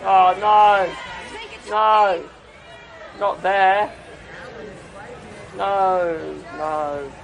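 loud, rising cries of oh no; loud disgusted no. Real, not acted. Reacting to gross clip.

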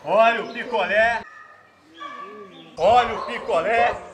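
loud drawn-out vendor cry; singsong beach vendor call. Acted. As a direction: call out slowly to the beach crowd. Vendor repeating his call.

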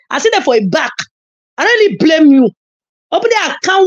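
ordinary talk

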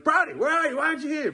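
high, mock exasperated calling; imitating himself hunting for his son; retold calling out. Dad retelling how he called for Brody, mock exasperated. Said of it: "I was like".

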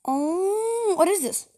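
high, startled disbelief; surprised. Real, not acted. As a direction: caught off guard, voice jumps up. Unexpected game result.

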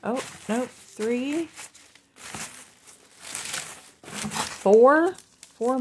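counting with a loud high four; excited count. Real, not acted. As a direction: count up thrilled, last one loud.

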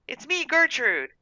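put-on high, slow little-girl voice; coaxing reassurance. A narrator's reading. Girl scooching closer to the cat, slow high coaxing.

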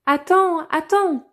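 high, loud call after her; crying out. A narrator's reading. Frog calling after the princess, high loud cry. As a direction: shout after the running princess. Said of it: "cria la grenouille".

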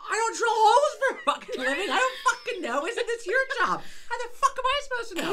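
laughing furious shout; exasperated yelling. Retold or imitated. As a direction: shout at him in disbelief. Exasperated shout at the coring guy.

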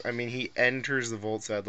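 normal talking voice

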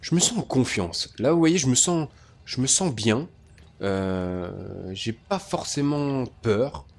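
usual speaking voice

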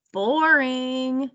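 drawn-out, unimpressed "boring"; bored dismissal. A narrator's reading. Martin rejects the idea of playing cards, bored. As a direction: stretch it out like a bored kid.

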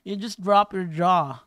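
slow, drawn-out mock American accent; playful American accent, stretched out; American accent demo. Shown as a demonstration. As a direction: drop the jaw for an exaggerated American accent. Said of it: "pag yung American accent kasi mas madali".

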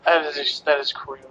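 normal talking voice

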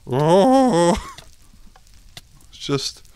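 high loud startled oh; alarmed gasp. Real, not acted. As the game danger hits, loud high alarmed oh. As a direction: yelp in alarm.

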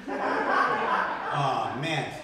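ordinary talk, nothing marked

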